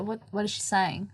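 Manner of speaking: very quiet mimicked aside; hushed retold question. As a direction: imitate people muttering behind someone's back. Retold people muttering to each other.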